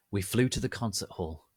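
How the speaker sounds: ordinary delivery, nothing marked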